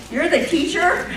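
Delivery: high, loud amused disbelief; surprised laughing question. Retold or imitated. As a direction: ask it half laughing in disbelief. Kids shocked at young teacher.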